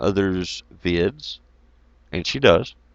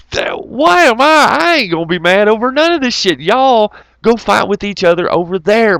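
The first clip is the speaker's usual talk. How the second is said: exasperated rant; 10 semitones higher, 4 dB louder than usual. Real, not acted.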